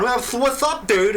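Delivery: high, loud spluttering imitation; spitting cracker-mouth talk. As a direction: talk as if spraying dry crackers. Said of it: "now we're all talking like that".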